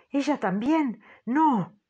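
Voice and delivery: grito agudo y fuerte de horror; niega, horrorizada, en voz alta; espanto. A narrator's reading. La señora Brink ve a Madeleine. (la señora Brink grita horrorizada)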